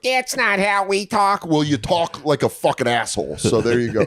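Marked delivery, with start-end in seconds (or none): 0.0-1.5 mocking Bills fan. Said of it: "They're like".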